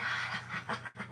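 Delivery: breathy demo laugh out on the exhale; hearty laugh. Shown as a demonstration. Leading laughter yoga viewers, breathy hearty laugh. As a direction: let a big laugh out as you twist. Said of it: "big hearty laugh".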